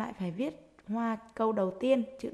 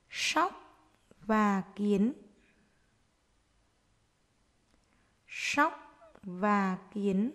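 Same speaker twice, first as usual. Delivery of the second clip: dictating; slower than usual. Shown as a demonstration. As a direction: dictate word by word to young pupils. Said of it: "hãy nghe cô giáo đọc để chúng mình viết".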